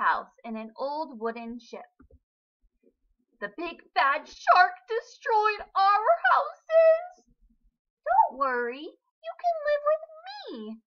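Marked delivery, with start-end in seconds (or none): 3.4-7.3 high, loud, slow panicky fish; frightened little fish. A narrator's reading. Scared fish tell sister. 8.0-10.8 higher reassuring sister fish; big sister fish. A narrator's reading. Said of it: "said Kim".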